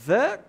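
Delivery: THE loud and drawn out with an uh vowel; THE as "thuh". Shown as a demonstration. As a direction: punch THE as "thuh". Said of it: "Então, a pronúncia é o".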